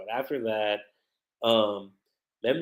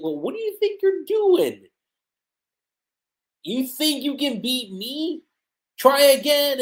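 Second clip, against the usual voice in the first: defiant Lucy; 12 semitones higher, 4 dB louder than usual. Retold or imitated. Lucy defiant in yokai form.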